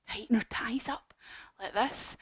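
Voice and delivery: whispered instruction. Shown as a demonstration. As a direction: whisper it softly to the kids. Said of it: "whispered instruction to children".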